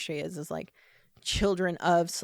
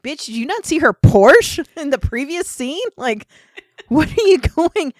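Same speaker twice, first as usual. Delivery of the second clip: loud, high, incredulous call-out; mock indignant outburst; 8 semitones higher, 13 dB louder than usual. Viewer yelling at snobby clerk in film, mock indignant. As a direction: scold the clerk in playful outrage.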